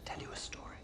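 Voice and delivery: menacing hush. Acted. Interrogator confiding menacingly.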